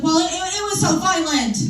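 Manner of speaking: high, fussy mom voice; imitating mom. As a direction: mimic her prim, fretful way of talking. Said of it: "That's how she talks".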